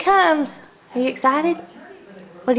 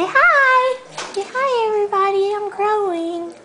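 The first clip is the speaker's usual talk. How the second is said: put-on baby talk; 8 semitones higher than usual. Voicing baby, high playful.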